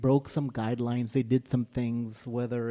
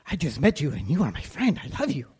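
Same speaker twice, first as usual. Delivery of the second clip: loud, gushing dog voice from the film; excited talking dog; 3 semitones higher, 8 dB louder, faster than usual. Retold or imitated. Retelling the dog from Up to the church, loud gushing dog voice. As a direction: play the dog bursting with sudden love. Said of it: "they're like, it's like".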